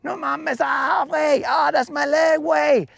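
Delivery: high, loud panicked bug voice, pleading in Spanish slang; desperate high yelling bug; frantic dying bug. Pretend. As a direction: squeal and beg like a bug losing its leg. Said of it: "I'd be like".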